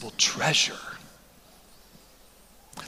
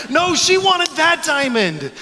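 laughing correction; 9 semitones higher, 6 dB louder, slower than usual. Real, not acted. Laughing correction after crowd.

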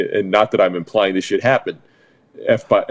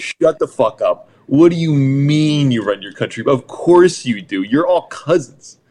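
mock angry rant; 5 semitones higher than usual. Real, not acted. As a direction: scoff at the Swedes, exaggerated. Ranting back at Sweden.

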